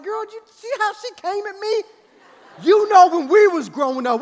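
high-pitched, worked-up self-defence; indignant protest. Retold or imitated. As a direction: argue back at your wife, outraged. Retold indignant defence to wife.

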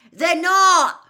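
very loud, high retort; full-volume shouted denial; roar. A narrator's reading. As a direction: roar the contradiction back. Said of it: "roared Ron".

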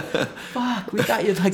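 high, loud laughing exclamation; laughing relief. Retold or imitated. Laughing relief after false alarm.

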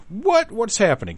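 high squeaky disbelief; amazed. Real, not acted. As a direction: yelp of real surprise at the find. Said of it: "Can't believe it".

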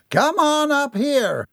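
high, slow, drawn-out call; captain's order. A narrator's reading. Captain ordering the Binkleys to the platform, calling out. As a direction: summon them up to the stage. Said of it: "the captain ordered".

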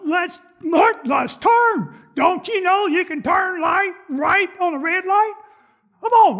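high, loud mock yell at other drivers; impatient family member. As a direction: ham up a relative yelling in traffic. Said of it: "They said".

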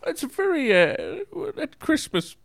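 falsetto caller voice; high put-on voice. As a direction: go high and airy.

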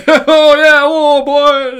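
high, loud mock Guido whooping; Guido soldiers. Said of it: "military is actually Guido's".

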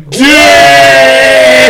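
excited exclamation. Real, not acted. Reacting to harsh poll comment.

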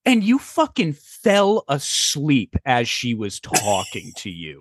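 slow, deliberate mock accusation; imitated slow, incredulous scolding; drawn-out reveal. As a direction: spell out the damning punchline word by word. Wife reveals his blunder, punchline.

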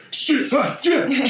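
high, strained panicked cursing; panicked curse. Retold or imitated. Player panicking as his shot heads for the goalie, high frantic curse. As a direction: blurt it out in a high panic as the puck flies.

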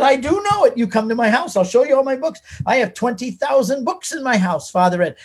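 high-pitched, emphatic retold argument; insistent rebuttal. New convert insists to priest he knows enough, emphatic. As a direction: argue your case eagerly, voice lifted high.

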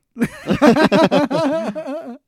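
loud laugh. Real, not acted. Big laugh.

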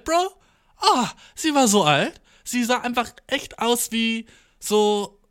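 high, slowed, stretched-out disbelief; incredulous exaggeration. Real, not acted. Telling viewers about the ancient doctor, high and drawn out. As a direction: drag it out in amazement.

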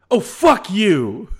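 slow, loud outburst; sudden yell. Retold or imitated. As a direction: blurt it out at full volume. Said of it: "just this thing came out of me".

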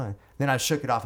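normal talking voice

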